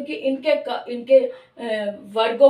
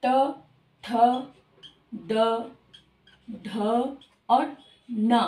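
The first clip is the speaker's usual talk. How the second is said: slow recital; 3 semitones lower, 3 dB quieter, much slower than usual. Shown as a demonstration. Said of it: "इसका पांचवा स्थान न है".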